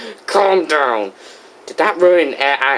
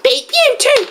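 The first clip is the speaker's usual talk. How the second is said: high cartoon comeback; high-pitched retort; Diddy Kong. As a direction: fire the insult back in a high character voice. Diddy returns host's insult.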